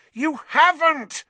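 high, loud, drawn-out yell. A narrator's reading. Boy contradicting the Minister, yelling. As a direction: yell it in furious denial. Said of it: "Harry yelled".

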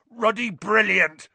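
big, loud, high-pitched cheer; booming. A narrator's reading. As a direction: boom it out slowly over the crowd. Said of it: "boomed Hagrid".

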